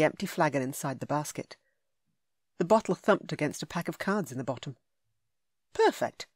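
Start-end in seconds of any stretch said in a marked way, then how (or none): 5.7-6.3 much higher, bright exclamation; pleased. A narrator's reading. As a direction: say it with sudden delight. Hero's thought, pitched up.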